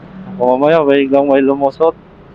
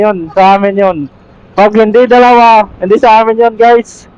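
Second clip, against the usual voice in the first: higher, louder hyped vlogger pitch; channel plug; 7 semitones higher, 4 dB louder than usual. Acted. Vlogger begging viewers to subscribe, upbeat and higher.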